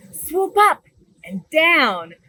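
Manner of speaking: bright, lively storybook read-aloud; animated picture-book reading; reading to children. Acted. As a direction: read it big for a room of little kids.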